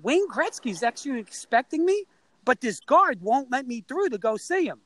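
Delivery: high-pitched retold appeal; pleading kid. Kid asking news anchor for help past guard, acted pleading. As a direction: plead your case as a young boy would.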